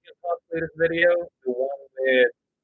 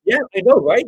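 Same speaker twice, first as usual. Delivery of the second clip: excited; 8 semitones higher, 9 dB louder than usual. Real, not acted. Reacting to chat comment.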